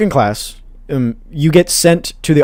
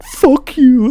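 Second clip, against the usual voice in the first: high-pitched imitation of an annoyed friend; mock cursing; 11 semitones higher, 3 dB louder than usual. Friend cursing at the classmate laughing at him, mock annoyed. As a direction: mimic a friend's curse, voice jumping way up.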